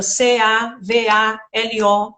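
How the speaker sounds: slow, each letter apart; spelled letter by letter. Shown as a demonstration. Teacher spelling a word out slowly for parents. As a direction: spell it out one letter at a time. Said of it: "não só letrem a letra".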